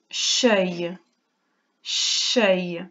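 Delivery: cheuille said slowly, low, twice; dictation sounding out. Shown as a demonstration. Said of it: "Et en dernier".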